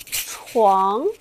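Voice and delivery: 床 rising in pitch; rising second tone. Shown as a demonstration. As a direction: let 床 climb upward. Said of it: "your voice become more and more higher".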